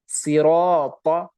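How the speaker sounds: सिराता slowly, with heavy full रा; heavy siraata. Shown as a demonstration. Teacher models the heavy alif after रा, slow. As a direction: stretch सिराता with a thick aa. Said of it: "अलिफ से पहले रॉ पर जबर है".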